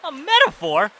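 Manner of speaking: incredulous. Acted. Incredulous repeat of word.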